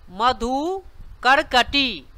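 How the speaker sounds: मधुकरकटी drawn out slowly, syllable by syllable; slow repeat. Shown as a demonstration. Teacher repeats मधुकरकटी slowly so students can copy. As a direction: stretch मधुकरकटी so learners catch each part. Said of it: "धीरे धीरे बोलें".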